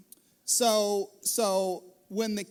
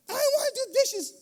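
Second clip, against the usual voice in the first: put-on high, loud, complaining child voice; squealing sibling squabble; whiny kid; 11 semitones higher, 4 dB louder than usual. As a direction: bicker like a kid dodging chores. Kids bickering over dishes.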